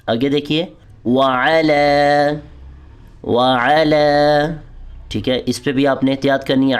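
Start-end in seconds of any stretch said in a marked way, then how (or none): 1.1-4.1 وعلی with the لام vowel drawn out; long lam. Shown as a demonstration. Teacher showing the long vowel in وعلی. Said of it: "لام کو آپ نے لمبا کرنا ہے".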